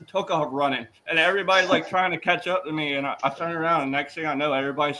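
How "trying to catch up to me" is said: usual speaking voice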